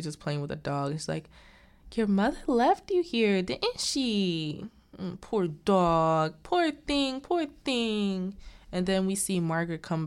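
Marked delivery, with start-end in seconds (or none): 1.9-8.3 high, drawn-out pitying coo; acted baby-talk sympathy for a pet; cooing to a dog. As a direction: coo over a poor little dog. Voicing woman fussing over dog.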